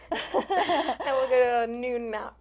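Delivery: laughing through the words; slow, giggling through it. Real, not acted. Laughing reply.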